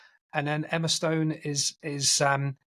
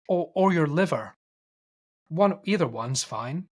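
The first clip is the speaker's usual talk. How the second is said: lightly voiced husband, higher and a bit louder; mock-polite cannibal husband asking his wife; a hint of creepy courtesy; hammed-up politeness; 4 semitones higher, 3 dB louder than usual. Husband asks wife for more.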